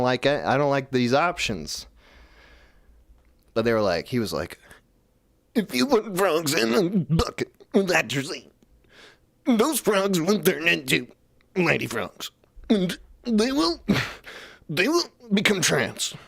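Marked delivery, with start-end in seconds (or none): 5.5-16.1 high, slowed put-on voice; hammed-up imitation of a grave warning; mock RFK voice. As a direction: play him up with a raised, drawn-out pitch. Quoting RFK, high, far, slow.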